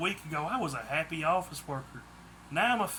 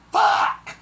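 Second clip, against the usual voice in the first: much higher, louder panicked yell; shouted in unison; 10 dB louder than usual. A narrator's reading. Kidnappers realise who he is, loud shocked shout. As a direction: bellow it in alarm. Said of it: "the kidnappers shouted in unison".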